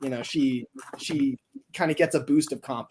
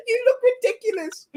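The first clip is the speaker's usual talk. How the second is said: mocking laugh; 4 dB louder than usual. Car buddies mock him.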